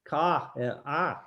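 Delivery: ka with a wide open "ah"; open "ah" vowel. Shown as a demonstration. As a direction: isolate the "ah" sound of ka. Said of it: "that kind of sound".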